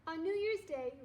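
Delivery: ordinary delivery, nothing marked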